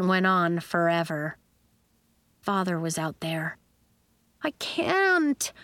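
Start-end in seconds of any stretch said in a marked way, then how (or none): 4.4-5.5 high, drawn-out moan of dread. A narrator's reading. Nori before performing for her father, moaning. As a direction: moan it in mild despair. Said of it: "Nori moaned".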